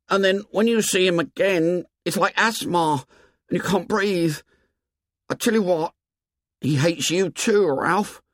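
put-on high, earnest boy's voice; high-pitched, worried confession; Piggy confiding fear. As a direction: confide anxiously in a high boyish voice.

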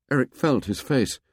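usual speaking voice

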